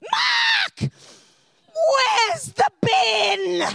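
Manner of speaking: high, drawn-out yell for a husband; mock-calm shout. Wife with a cold calling husband, furious hammed-up shout. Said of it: "So I very calmly said".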